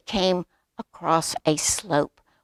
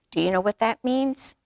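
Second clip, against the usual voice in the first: ordinary delivery, nothing marked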